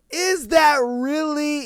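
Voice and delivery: high, drawn-out disbelief; incredulous challenge. Real, not acted. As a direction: ask it high, stretching out the disbelief.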